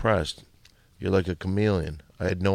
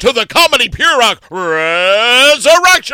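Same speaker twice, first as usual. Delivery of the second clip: much higher, louder announcer yell; rock host shout; 14 semitones higher, 10 dB louder than usual. Shown as a demonstration. [name] outshouts Scaremaster with a booming rock intro. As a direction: hype the crowd. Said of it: "host showdown".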